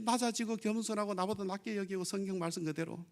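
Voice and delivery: ordinary delivery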